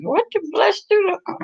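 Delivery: high-pitched retold wondering; lightly acted high query; retold puzzled question. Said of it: "I was like".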